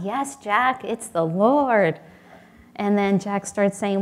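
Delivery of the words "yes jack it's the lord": loud, drawn-out divine reply; the Lord's voice. Retold or imitated. The Lord's voice answers Jack.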